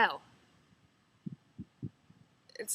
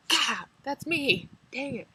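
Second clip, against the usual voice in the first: annoyed groan; 5 semitones higher, 5 dB louder, slower than usual. Retold or imitated. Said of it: "'cause it's like".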